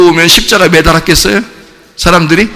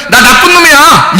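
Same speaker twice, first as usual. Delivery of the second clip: high, forceful declaration; blunt confession; 8 semitones higher, 3 dB louder than usual. Shown as a demonstration. Preacher models honest admission of being bad, blunt loud confession. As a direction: blurt it out openly, holding nothing back.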